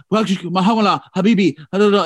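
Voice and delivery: lightly faked Arabic phrasing; a hint of a mock Arabic accent. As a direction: toss off some Arabic words like a tourist. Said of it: "she's all".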